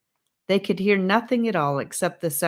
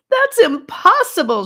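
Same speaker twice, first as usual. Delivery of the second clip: high, loud, tearful denial; sobbing protest; 11 dB louder than usual. A narrator's reading. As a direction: cry it out in disbelief. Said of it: "she sobbed".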